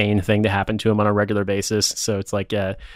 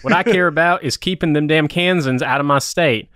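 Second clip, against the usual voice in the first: angry Missouri yokel; 6 semitones higher than usual. Pretend. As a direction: play a hot-headed Missouri local. Mocks cohost as angry Missourian.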